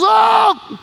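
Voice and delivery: Peter amazed, calling out; surprised exclaim. Retold or imitated. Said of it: "and Peter goes".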